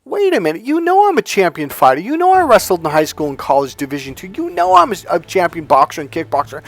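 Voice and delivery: high-pitched disbelieving protest; retold incredulity. Champion fighter answering girlfriend who doubted him, incredulous. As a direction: press each point in baffled disbelief.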